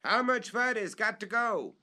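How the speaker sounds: distant holler from the treetop; halloo. Acted. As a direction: holler down from far up. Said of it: "in a sort of halloo".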